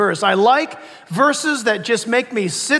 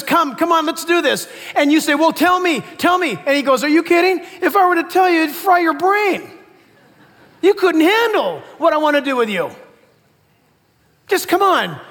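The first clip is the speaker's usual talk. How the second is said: eager invitation; 5 semitones higher than usual. Acted. Preacher voices God inviting eagerly.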